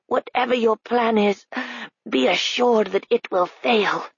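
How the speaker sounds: slow, strained officer voice; defiant colonel. A narrator's reading. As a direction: defy her through the paralysis.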